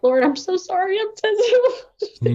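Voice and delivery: high, breaking apology with a sob; tearful plea for forgiveness; retold crying. Apologizing to the Lord at her car, crying. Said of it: "I'm actually crying".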